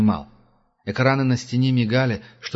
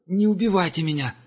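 begging; 8 semitones higher than usual. A narrator's reading. Captive begs for his life.

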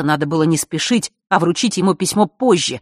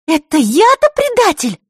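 indignant; 6 dB louder than usual. Acted. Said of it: "Так же тихо ... возмутилась Захара".

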